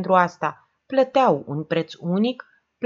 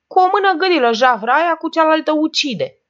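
much higher, loud shriek; screamed; 12 semitones higher, 8 dB louder than usual. A narrator's reading. Oprișan screams insults at the Englishwoman, high and loud. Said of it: "țipă Oprișan".

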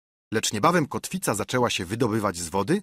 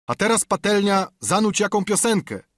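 much higher, louder call; 10 semitones higher, 4 dB louder than usual. A narrator's reading. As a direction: shout across the deck. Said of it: "zawołał jakiś głos". (wołanie marynarza)

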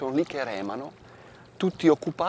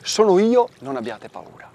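Jesus reassuring; 9 semitones higher, 8 dB louder than usual. Acted. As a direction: call out, then soften to calm them. Voicing Jesus reassuring disciples.

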